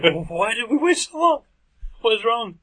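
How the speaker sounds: panicked. Retold or imitated. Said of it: "I was like".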